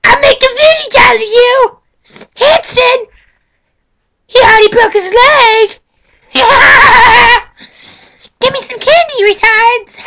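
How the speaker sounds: put-on high, loud cackling old granny. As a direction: shriek it like a cranky old granny. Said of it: "Today [name]'s gonna do his granny impression".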